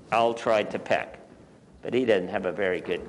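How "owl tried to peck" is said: ordinary delivery, nothing marked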